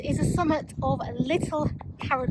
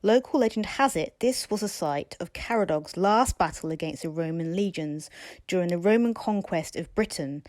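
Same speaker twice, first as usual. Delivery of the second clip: lower, calm voiceover; documentary narration; 6 semitones lower, 4 dB quieter than usual. A narrator's reading. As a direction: read it like a nature documentary.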